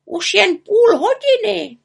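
very high, insistent raven; agitated high-pitched warning; urging. A narrator's reading. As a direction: press her urgently, loud and drawn out. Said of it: "naléhal Abraxas".

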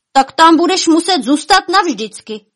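high, loud taunting reply; shouted retort. A narrator's reading. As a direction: shout it back across the stream. Quarrel shouted across the stream.

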